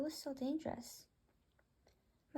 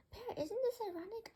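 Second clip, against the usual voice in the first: much higher, teasing question rising at the end; teasing bird voice; 10 semitones higher than usual. Acted. One bird teasing the other about being scared, high teasing bird voice. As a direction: poke fun at your friend in a squeaky bird voice.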